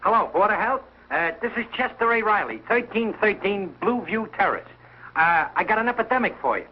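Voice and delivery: high-pitched, officious phone report; self-important alarm. Acted. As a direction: announce the emergency like a dutiful citizen. Urgently reports epidemic by phone.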